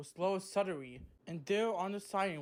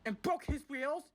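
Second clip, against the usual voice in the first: high, sharp telling-off; angry scolding; 11 semitones higher than usual. Acted. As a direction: snap at the naughty freight cars. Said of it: "she snapped angrily".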